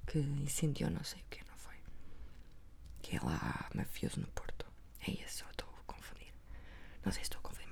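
soft gossipy whisper. Real, not acted. Sharing an unsure rumour about the ex-husband, whispered. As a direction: hush it so nobody hears the rumour. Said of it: "mas vou falar baixinho".

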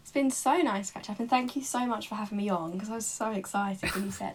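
ordinary talk

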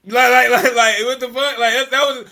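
loud exasperated outburst; what the hell, loud and incredulous; outraged. Real, not acted.

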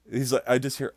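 ordinary talk

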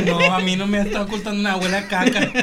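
hammed-up sniffing aunt, high on mija; a friend mocking the aunt who sniffs the room. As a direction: tease like a nosy aunt smelling something. Mocking the aunt's voice.

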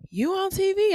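high, eager question; a caller thrilled to spot her on TV; excited caller. Retold or imitated.

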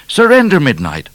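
high, loud call for surrender; shouted demand from a pursuing trooper. A narrator's reading. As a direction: call it out hard across a distance. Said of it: "called O'Grady".

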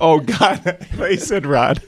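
high-pitched, laughing realization. Real, not acted. Guest realizing he misheard the host, laughing. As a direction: say it through real laughter, pitched right up.

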